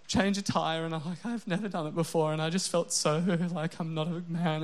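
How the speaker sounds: husband whining to his wife about a tire, mock-pathetic; self-mocking whine to wife. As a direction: whine like a man who just changed his first tire. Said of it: "sometimes it's just like".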